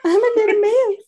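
high little mouse squeak; squeaky mouse voice. Pretend. Echoes mouse voice.

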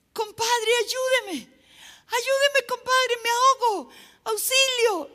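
high, loud cries of a drowning man; shouting for help. Acted. Drowning man to his compadre in a joke, shouting for help. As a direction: yell desperately for rescue, hammed-up. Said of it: "él empezó a gritar".

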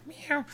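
high squeaky cat meow. Retold or imitated. Cat answering the threat with a high meow.

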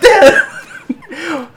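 very high imitated "blah"; goofy high outburst, imitating a surfer; mock surfer "blah". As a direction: squawk it like the viral clip. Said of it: "surfer dude from that, that viral clip".

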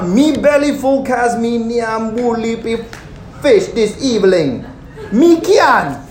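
put-on satisfied Caribbean accent, belly full. Preacher retrying the fed crowd in a Caribbean accent. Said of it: "I do my accents".

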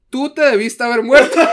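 laughing through a loud quote. Retold or imitated. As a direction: burst out laughing mid-quote. Quoting nutritionist, laughing.

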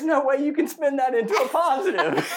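high-pitched, helpless laughing objection; laughing protest. Real, not acted. Man protesting the teasing about his hair, laughing. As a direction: object while cracking up.

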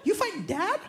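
put-on high, wide-eyed kid voice; a six-year-old gasping at big news; awed small boy; little kid amazed. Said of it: "And I'm six, I'm like".

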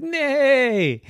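horse neigh. Acted. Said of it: "horse said".

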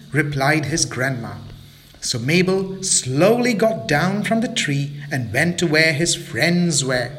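ordinary delivery, nothing marked

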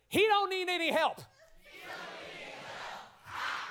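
very high shouted line, breathy "ha" after; full old-school preacher holler. Shown as a demonstration. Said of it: "I need a little bit more".

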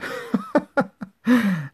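high, loud bright laughter; bursting laugh. Acted. Girl laughs at the dog in a knitted jacket. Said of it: "Da fange ich an zu lachen".

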